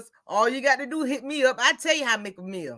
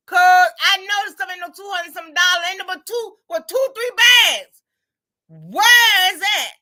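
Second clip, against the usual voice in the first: louder, higher, near tears over the bill; exasperated outcry; 6 semitones higher, 7 dB louder than usual. Real, not acted. Shocked at the grocery bill, she cries out in exasperation. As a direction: cry out the price like it can't be real. Venting about grocery prices.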